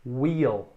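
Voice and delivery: Wheel with a long stretched ee. Shown as a demonstration. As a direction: draw out the ee in "Wheel". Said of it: "esse I um pouquinho mais longo".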